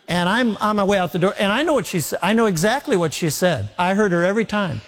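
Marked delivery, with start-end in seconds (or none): none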